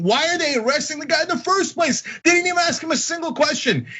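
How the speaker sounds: high-pitched, incredulous outburst; real indignation. Real, not acted. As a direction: protest in exasperated disbelief, pitch way up. Indignant questioning of the arrest.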